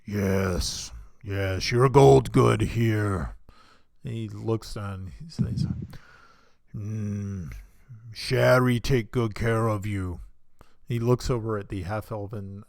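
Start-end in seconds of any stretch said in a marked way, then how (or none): 0.1-3.4 slow, loud, heavy half-orc voice; half-orc innkeeper. Acted. Giant half-orc tells the travelers his name, slow and loud. 6.7-10.2 slow, loud, heavy half-orc voice; half-orc innkeeper. Acted. Giant half-orc tells the travelers his name, slow and loud.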